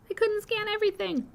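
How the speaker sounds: squeaky high-pitched repeat. Pretend. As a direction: squeak it out playfully.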